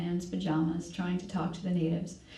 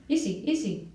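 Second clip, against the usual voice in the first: higher, clipped order in pidgin; barked pidgin; 4 semitones higher than usual. A narrator's reading. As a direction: hurry them along in pidgin. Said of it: "barked at them in pidgin".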